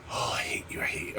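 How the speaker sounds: whispered under his breath; whispered frustration. Real, not acted. Fighting the taped package, whispered hatred. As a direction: hiss it out, fed up.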